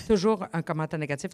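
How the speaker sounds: normal talking voice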